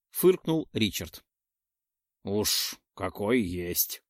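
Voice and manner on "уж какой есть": slow, lightly voiced modest troll. A narrator's reading. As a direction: shrug it off slowly, eyes down. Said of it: "скромно потупился тролль".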